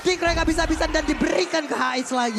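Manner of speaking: high, loud, driving play-by-play; fighting game announcer. Acted. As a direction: call the team fight like it's live. Live team fight play-by-play.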